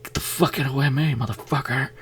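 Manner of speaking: put-on high, yelled at the kids; a female lead shrieking in a horror movie; mock yell. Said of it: "they'd be like".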